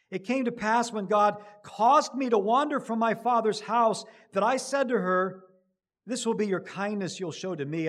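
loud, ringing scripture reading; preaching scripture aloud. Acted. Preacher reading Abraham's words to the congregation, ringing. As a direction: proclaim the verse to the hall.

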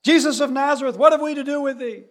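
high, loud shriek of the possessed; possessed man crying out high and loud; demon crying out. Acted. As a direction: cry out in alarm at Jesus. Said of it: "they cry out".

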